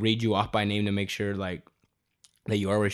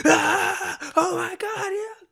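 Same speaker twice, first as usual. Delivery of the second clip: much higher squeals of excitement; cheering crowd; 4 dB louder, much slower than usual. Retold or imitated. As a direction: squeal like friends cheering a rock star. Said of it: "Everybody's like".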